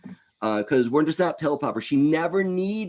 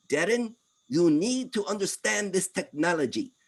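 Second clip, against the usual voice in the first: higher, badly faked Israeli accent for his friend; 9 semitones higher than usual. Ahuva urging Darren to learn it, mock Israeli accent. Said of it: "I'm not doing an Israeli accent very well".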